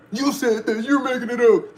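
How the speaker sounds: mock the disbelievers who call him a liar; mocking doubter.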